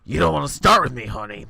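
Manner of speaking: loud, fast, sassy bear; sassy comeback. Acted. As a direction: snap back with sass. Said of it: "the bear shoots back".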